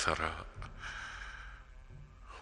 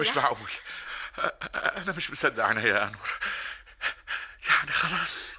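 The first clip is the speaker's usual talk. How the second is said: higher, stammering amazement; can't believe it; joyful disbelief; 6 semitones higher than usual. Acted. Older brother stammers in joyful disbelief at the news.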